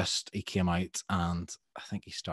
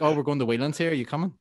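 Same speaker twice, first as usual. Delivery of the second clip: a lightly voiced casual invite, higher; breezy, friendly offer without the accent; 7 semitones higher, 5 dB louder, faster than usual. The singer inviting a fan to the pub, higher lightly voiced invite. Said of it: "I won't do the accent".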